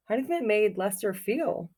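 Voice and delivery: plain reading voice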